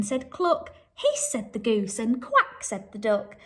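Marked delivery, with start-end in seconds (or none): 1.0-1.3 goose hiss. Acted. Teacher reading to children, a high goose hiss. 2.2-2.6 squeaky high quack; high duck quack. Acted. Teacher reading a farm story, a high duck quack.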